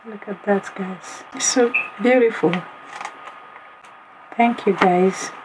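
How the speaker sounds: ordinary delivery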